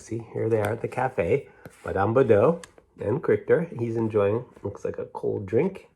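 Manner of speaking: ordinary delivery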